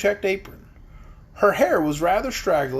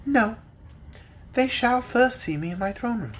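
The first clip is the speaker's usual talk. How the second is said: decidedly; 5 semitones higher than usual. A narrator's reading. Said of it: "replied Ozma decidedly".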